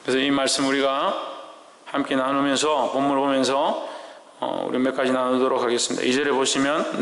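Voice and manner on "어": ordinary delivery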